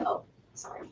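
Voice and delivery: quick whispered apology; whispered aside. Real, not acted. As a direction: breathe a hushed sorry. Aside while someone talks.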